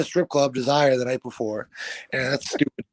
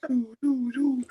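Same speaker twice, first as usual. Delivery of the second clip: siren hum; 11 semitones higher, slower than usual. Retold or imitated.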